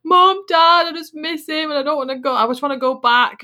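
imitating her teary self begging to go back; retold tearful plea. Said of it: "I was in tears".

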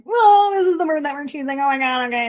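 high, alarmed whoa at an incoming bird; mock panic at the bird that chose you; startled. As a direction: caught off guard by a bird. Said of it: "you're like".

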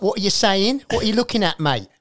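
put-on loud, high-pitched British street accent; UK rude boy accent. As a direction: square up like a mouthy UK rude boy. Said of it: "it's not U K approved".

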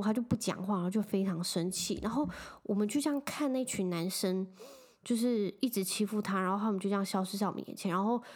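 ordinary talk

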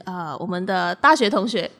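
ordinary delivery

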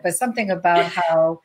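ordinary delivery

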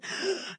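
quick high gasp of mock fright; sharp mock gasp. Coach acting out fear, with a quick high gasp. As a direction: gasp like something startled you in the dark. Acting out fearful walk in dark.

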